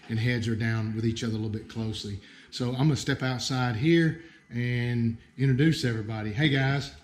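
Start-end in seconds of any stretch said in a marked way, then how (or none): none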